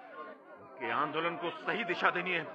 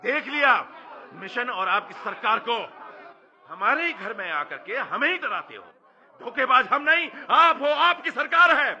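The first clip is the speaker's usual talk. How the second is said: high, impassioned tirade; angry defiance; 6 semitones higher, 3 dB louder than usual. Acted. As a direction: hurl accusations back at the priest as you quit. Birsa angrily quits the mission.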